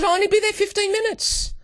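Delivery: high, fast mock wife voice; quick falsetto impression of a promising wife; wife's voice. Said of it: "she says".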